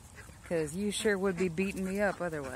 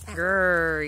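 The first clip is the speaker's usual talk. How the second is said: loud mock growl back at the duck; playful growl; 9 dB louder than usual. Teasing her attack duck with a loud mock growl. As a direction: growl like a cartoon beast, hammed up.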